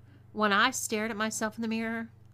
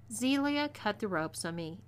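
plain reading voice

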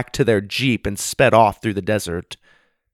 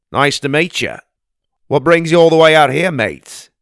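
put-on Australian accent; 6 semitones higher, 7 dB louder than usual. Aussie bushman greeting the kids in the pond, hearty Australian accent. Gundy speaks.

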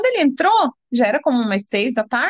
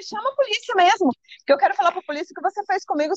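high-pitched, insistent retort; retold defiance; 8 semitones higher than usual. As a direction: retell standing up to him, pitch raised. Retold quarrel, daring him. (réplica desafiadora recontada, em tom agudo)

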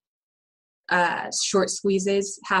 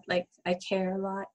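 normal talking voice

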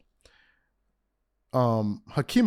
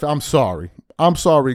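ordinary talk, nothing marked

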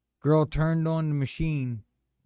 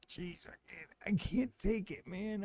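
high, hushed, strained groan of pain; pained plea; 7 semitones higher, 8 dB quieter than usual. Retold or imitated. Man retelling his falls on the job, pained. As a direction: quietly reenact gasping agony, pitch lifted.

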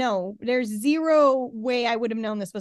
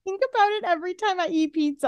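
ordinary talk, nothing marked

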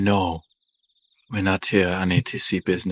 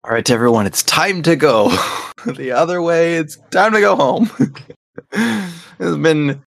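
mock cheerful farewell; 8 semitones higher, 6 dB louder than usual. Players joke about leaving.

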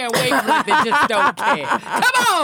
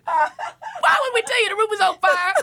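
high carer voice; 8 semitones higher than usual. Pretend. Reassuring seniors.

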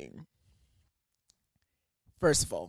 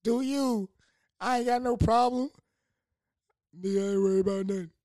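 high hyped voice; 9 semitones higher than usual. Retold or imitated. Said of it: "I'm like".

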